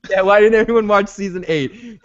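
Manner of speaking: high-pitched mock indignation; hammed-up plaintive echo of a complaint; mock plea.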